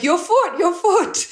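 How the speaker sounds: high-pitched retold yell; high, insistent mock shout. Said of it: "Why are you yelling at me".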